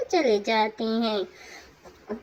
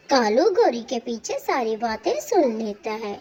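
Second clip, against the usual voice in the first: ordinary delivery, nothing marked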